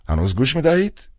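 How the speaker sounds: put-on a cold old man checking the line; old official asking. A narrator's reading. Director asking the silent clown on the phone, prodding.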